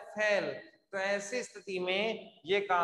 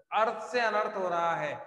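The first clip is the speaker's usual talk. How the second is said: ordinary delivery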